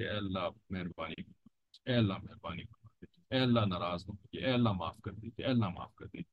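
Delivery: soft, low murmured supplication; humble plea to God. Acted. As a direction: plead quietly, as if anxious for pardon. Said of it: "बस हर वक्त कैफियत यही हो".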